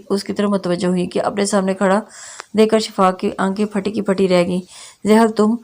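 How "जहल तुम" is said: ordinary delivery, nothing marked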